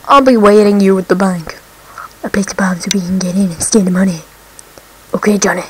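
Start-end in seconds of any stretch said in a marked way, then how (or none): 2.2-4.2 fast conspiratorial whisper. Acted. Gamer reading the crook's plan to viewers, fast whispered. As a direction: hiss it like a bank-job plan.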